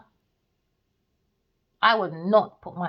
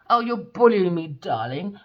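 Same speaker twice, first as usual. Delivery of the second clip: mocking quote of her; 7 dB louder than usual. Mocking the actress's words.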